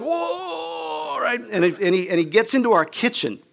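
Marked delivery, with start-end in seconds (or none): 0.0-1.2 long high imitated wail; pained moaning. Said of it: "Andy's like".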